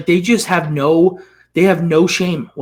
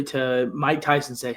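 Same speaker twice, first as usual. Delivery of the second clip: ordinary talk, nothing marked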